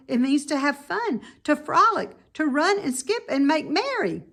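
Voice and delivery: high, bright explaining; eager piglet. Acted. Wilbur explaining play to the rat, high and eager. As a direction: cheerfully sell the fun. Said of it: "said Wilbur".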